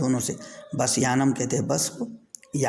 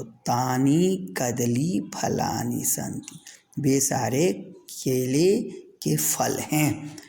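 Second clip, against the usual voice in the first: slow read-aloud; slower than usual. Shown as a demonstration. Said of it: "teacher reads slowly".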